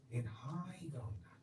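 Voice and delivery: soft, fast, tender comfort from a hospital bed; gentle reassurance. Retold or imitated. Dying master soothes his doctor, soft and tender. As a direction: reassure him quietly that all is well. Repeating Swami's reassuring words.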